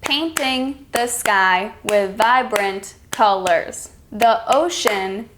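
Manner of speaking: slow, syllable by syllable, one beat per clap; slow clapped-out reading. Shown as a demonstration. Teacher clapping out a sentence for students, slow and even. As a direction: read it slowly like you're clapping each syllable. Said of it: "I will clap them out".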